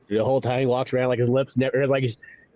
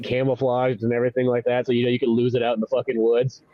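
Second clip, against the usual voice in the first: normal talking voice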